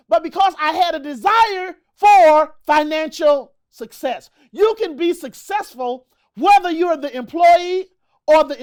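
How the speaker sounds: loud, driving preacher-like delivery; impassioned motivational speech. Acted. Motivational speaker rallying entrepreneurs to take responsibility, impassioned. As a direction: punch out the key words with conviction.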